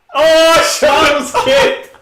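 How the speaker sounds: high, loud and slow, laughing it out; gleeful shout. Real, not acted. Friend shouts gleefully that Sean got kicked, laughing. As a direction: crow over the news with delight.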